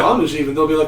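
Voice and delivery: ordinary delivery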